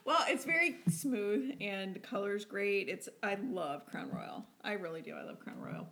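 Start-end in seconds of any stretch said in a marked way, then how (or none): 0.1-1.5 laughing. Real, not acted. Laughing after pomegranate joke. 5.1-5.9 breathy whispered aside. Real, not acted. Repeats line in a whisper.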